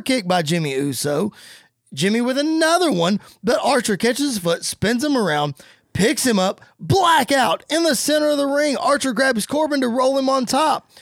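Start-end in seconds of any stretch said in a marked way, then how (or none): 1.9-10.9 rising excited play-by-play call; wrestling commentator. Acted. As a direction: call the finish like a ringside announcer.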